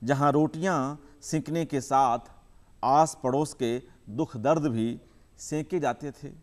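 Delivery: measured recital with long pauses between phrases; poem recitation. Acted. Poet reciting his poem to the audience, measured and unhurried. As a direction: savour each image, let the lines breathe.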